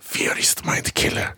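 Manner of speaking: very slow, hushed whispery recital; famous movie line. Acted. Asked to repeat the famous Dune line, slow dramatic whisper. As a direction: whisper the credo, drawing out each word. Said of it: "한 번만 더 해줘요".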